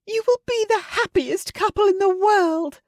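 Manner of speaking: very high, loud, overjoyed squeal; Missus Bennet crying out. Acted. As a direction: gush it out in a very high voice. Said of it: "she cried".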